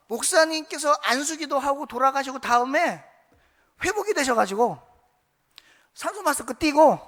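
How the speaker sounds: loud, breathless good news on the phone; overjoyed daughter calling her pastor, loud; excited daughter. Retold or imitated. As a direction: gush the miracle news to the pastor.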